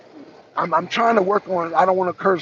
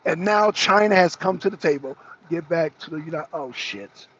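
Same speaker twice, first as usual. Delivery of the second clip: ordinary talk, nothing marked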